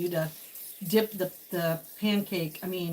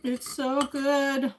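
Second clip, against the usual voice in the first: savoring; 6 semitones higher, 4 dB louder, much slower than usual. Real, not acted. Savoring food, drawn out.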